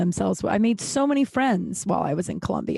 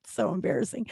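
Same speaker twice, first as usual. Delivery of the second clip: usual speaking voice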